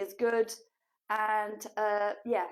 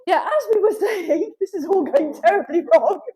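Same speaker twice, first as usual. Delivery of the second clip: loud, higher, laughing through the words; giggling exasperation, out of control; laughing while straining; 5 semitones higher, 10 dB louder than usual. Real, not acted. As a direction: laugh at the chaos as you talk. Laughs while holding heavy dog.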